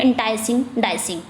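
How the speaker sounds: "Enticing, dicing" tossed off with no stress on the last syllable; quick, unstressed ending. Shown as a demonstration. Teacher showing students a feminine rhyme, quick with an unstressed ending on "enticing, dicing". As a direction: say "enticing, dicing" quickly, letting the final "Singh" fall away unstressed. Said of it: "हम जल्दी से बोल लेते हैं".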